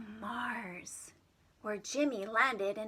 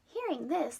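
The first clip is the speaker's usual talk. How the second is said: ordinary delivery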